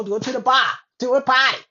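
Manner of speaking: loud, punchy mock Boston accent. Showing how people speak differently, loud Boston accent. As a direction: lay on a Boston accent, punching the last word. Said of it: "that's Boston".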